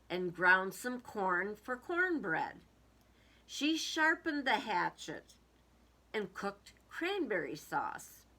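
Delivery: lively picture-book reading with hatchet lines stressed; reading to children. A narrator's reading. Teacher reading a Thanksgiving story to children, playful. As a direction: read it aloud to a class with playful suspense.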